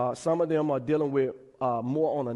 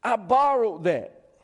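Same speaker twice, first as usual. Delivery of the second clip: mild mock scream, raised and louder; retold cry of alarm; 5 semitones higher, 4 dB louder than usual. Axe-swinger cries to his master over the lost borrowed axe, mild retold scream. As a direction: cry out in dismay, half acted. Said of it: "he screams out".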